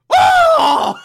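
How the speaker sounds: put-on high squealing baby-talk shriek; Aunt Harriet impression. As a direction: shriek like a fussy old aunt. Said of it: "my new Aunt Harriet impression".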